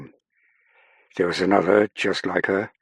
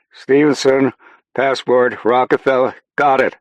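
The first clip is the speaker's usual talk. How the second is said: loud, raised confirmation shout; shouting back; 7 semitones higher, 8 dB louder than usual. A narrator's reading. Airman repeating the contact details to Saffron, shouting back. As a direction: shout each item back over the din. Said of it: "Stackpole shouted back".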